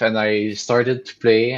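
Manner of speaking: usual speaking voice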